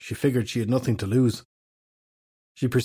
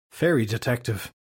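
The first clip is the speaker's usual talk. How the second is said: skeptical. Acted. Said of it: "Fahey said skeptically".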